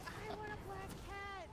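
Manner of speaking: mock whiny plea; pleading. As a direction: ask it like a begging kid. Retold own childish want.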